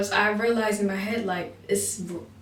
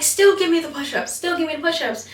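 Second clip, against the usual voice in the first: high, raised-voice trainer demand; imitated screaming; 8 semitones higher, 5 dB louder than usual. Trainer orders trainee to do push-ups, high mock yell. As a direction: yell the order like a drill instructor. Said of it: "she started screaming".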